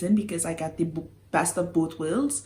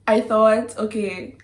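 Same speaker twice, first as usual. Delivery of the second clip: usual speaking voice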